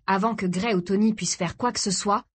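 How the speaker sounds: ordinary delivery, nothing marked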